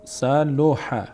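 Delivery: each syllable drawn out very slowly; slow demo for repeating. Shown as a demonstration. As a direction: spell out سلوحه slowly so the class can copy it. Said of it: "تکرار کنید".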